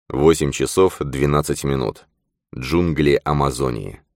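ordinary talk

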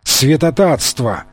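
usual speaking voice

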